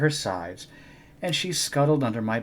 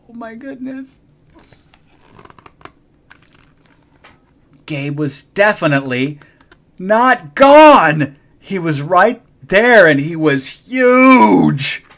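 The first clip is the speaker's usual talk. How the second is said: much higher and louder, slowing in shock; astonished; 10 semitones higher, 15 dB louder, slower than usual. Acted. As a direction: build to a stunned gasp. Child sees giant Gabe.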